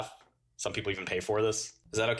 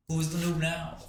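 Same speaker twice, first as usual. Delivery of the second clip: higher gloating repeat; 6 semitones higher than usual. Pretend. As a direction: repeat the taunt, still gloating. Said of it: "And I'm gonna say".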